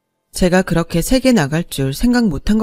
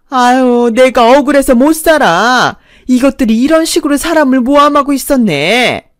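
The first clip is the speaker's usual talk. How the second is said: loud, higher wronged cry; stubborn protest; 5 semitones higher, 8 dB louder than usual. Acted. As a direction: insist you're the victim, indignant and loud. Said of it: "억지를 부렸고". (경찰 앞에서 억울하다고 우기는 큰 소리)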